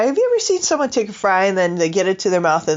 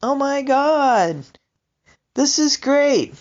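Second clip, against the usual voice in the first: slow, drawn-out delight, a bit higher; cat voice, delighted; 8 semitones higher, slower than usual. Retold or imitated. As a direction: savor it like a thrilled cat. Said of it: "And she's like".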